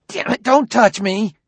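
high, loud, frantic outburst; panicked shout. Acted. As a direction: yell in alarm to fend someone off. Swings wildly at approaching robot.

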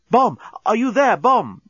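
high, loud anxious call; calling into phone. Acted. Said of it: "Nettie called into the phone".

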